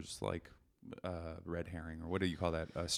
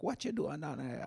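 light Belizean patois, pitched higher; 7 semitones higher, 3 dB quieter than usual. Retold or imitated. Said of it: "they're like".

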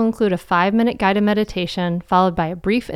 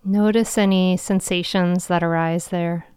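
guided meditation. Acted. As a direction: guide them into calm, with long pauses.

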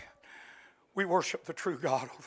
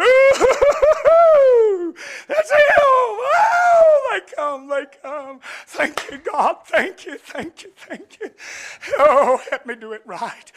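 higher, louder, half-crying Joseph thanking God; overcome Joseph; 13 semitones higher, 14 dB louder, much slower than usual. Acted. Joseph alone behind the door seeing his brothers, weeping with joy. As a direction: break open with joy and tears.

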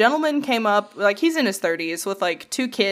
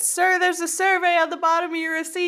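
mock whiny plea; 8 semitones higher than usual.

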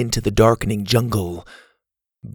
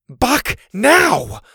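high, raised-voice order; urgent command; 11 semitones higher, 4 dB louder than usual. A narrator's reading. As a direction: call the dog sharply in alarm. Boy commands dog in danger.

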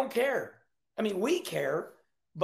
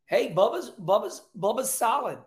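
usual speaking voice

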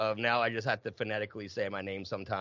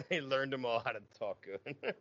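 chuckling, quieter echo of a joke; amused echo; 3 semitones higher, 4 dB quieter than usual. Real, not acted. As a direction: repeat it softly through laughter. Repeats the joke, laughing.

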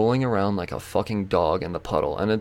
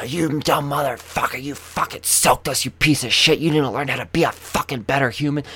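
higher-pitched angry tirade, re-enacted; retold losing it; 5 semitones higher than usual. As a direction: replay yourself tearing into him. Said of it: "just fucking lost it on him".

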